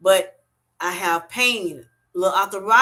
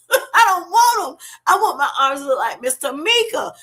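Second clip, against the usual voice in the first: higher and louder, laughing it out; laughing excited; 9 semitones higher, 5 dB louder than usual. Real, not acted. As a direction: laugh off the flabby arms, keep from crying. Joking about flabby arms, laughing.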